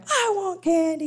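high, loud imitated kid's whining cry; child crying for candy at the store, loud mock wail. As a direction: wail it like a child throwing a tantrum. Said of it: "He's just gonna cry".

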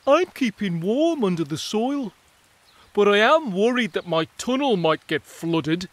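content, then worried about flooding; worried mole. Acted. Mole telling the others about its tunnel.

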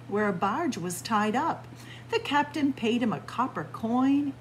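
usual speaking voice

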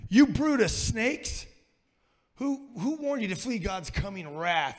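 bellowed denunciation; shouting prophet. Retold or imitated. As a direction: thunder it at the snobs like a desert prophet. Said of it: "he's shouting out to them".

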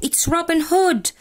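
a touch of a shout from the crowd; excited cry from the crowd. A narrator's reading. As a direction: call out the name like you just spotted him. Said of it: "Cried a voice in the crowd".

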